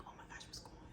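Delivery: panicked whisper under the breath; freaking out. Retold or imitated. Patient overhearing the surgeon, freaking out in a whisper. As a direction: whisper it in alarm. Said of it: "I'm freaking out".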